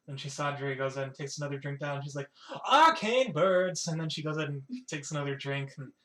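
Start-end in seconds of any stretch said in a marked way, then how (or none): 2.7-3.9 loud, raised, drawn-out exclamation; excited blurt. Acted. Chisadri blurts after another drink, excited. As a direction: stretch both words out with gleeful volume.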